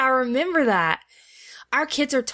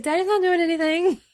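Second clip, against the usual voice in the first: innocent cover-up; 8 semitones higher than usual. Retold or imitated. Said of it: "And I was like".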